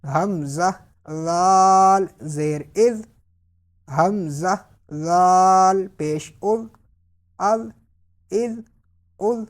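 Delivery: drawn-out drill with unwhistled dhal; no whistle. Shown as a demonstration. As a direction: end "از" on a dh as in "this", without sibilance. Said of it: "سیٹی کی آواز نہیں نکالنی".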